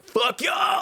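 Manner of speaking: high-pitched comic shout; mock defiant yell. Imitating an actress cutting across a tearful winner, mock defiant yell. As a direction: yell it out as a brash send-up. Mocking actress yelling at everyone.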